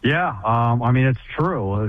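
usual speaking voice

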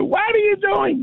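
very high-pitched, drawn-out mock scream; imitated screaming. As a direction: screech it like mother yelling from upstairs. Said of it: "hear screaming from the upstairs".

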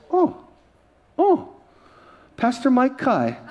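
high little ohs, then slow recognition; surprised airline lady. Retold or imitated. Lady checking his photo ID, high mock-surprised recognition. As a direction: imitate her dawning recognition of a name. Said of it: "She looks".

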